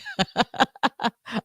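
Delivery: a high bright giggle at her own patois attempt. Real, not acted. Radio host giggling at her own bad patois. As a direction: giggle it off, amused at yourself.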